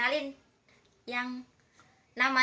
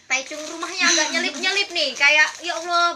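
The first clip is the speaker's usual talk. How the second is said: loud, higher, laughing through the words; laughing complaint; 5 semitones higher, 9 dB louder than usual. Real, not acted. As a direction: laugh it off while griping about the remote house. Complaining laughingly about remote house. (mengeluh sambil tertawa keras)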